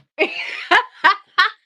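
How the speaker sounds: loud high burst of laughter; real delighted laugh. Real, not acted.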